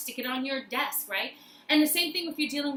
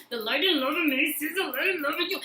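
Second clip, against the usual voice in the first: higher, with a laugh and a catch; higher emotional repeat with a laugh; laughing through the mantra; 4 semitones higher, 3 dB louder than usual. Shown as a demonstration. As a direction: repeat it moved, half laughing. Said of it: "look at them and say".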